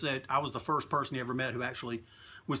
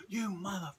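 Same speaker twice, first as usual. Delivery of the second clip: strangled, higher scream kept quiet; retold mock scream; 4 semitones higher, 8 dB quieter than usual. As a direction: imitate screaming without the volume. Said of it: "He's screaming at me".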